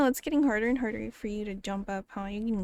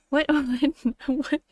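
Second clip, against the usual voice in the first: ordinary delivery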